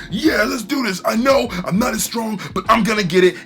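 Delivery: rally yourself against a stronger foe; determined hero. Retold or imitated. Mumen Rider charging in.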